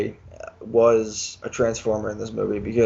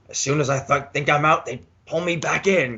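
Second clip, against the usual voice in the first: lightly acted mobster lament, pitched up; a hint of gangster-film drama; Godfather quote; 3 semitones higher than usual. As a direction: quote the famous movie line with mock exasperation. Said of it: "kind of a Godfather".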